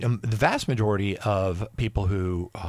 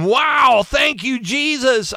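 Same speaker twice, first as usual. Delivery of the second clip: high, loud cry of joy and thanks; overjoyed shout of praise; ecstatic cry; 14 semitones higher, 7 dB louder, slower than usual. Real, not acted. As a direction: burst out thanking Jesus, overwhelmed. Overwhelmed at the driveway.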